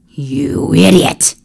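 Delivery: furious emperor; angry snap. Acted. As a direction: spit out the insult. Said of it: "Bellows snapped".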